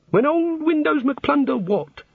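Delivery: very high, slow puzzled echo; baffled god. A narrator's reading.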